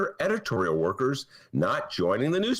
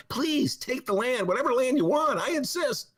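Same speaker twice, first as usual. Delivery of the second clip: mock eager pleading; 13 semitones higher than usual. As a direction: beg them to take it, way up high. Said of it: "It was like".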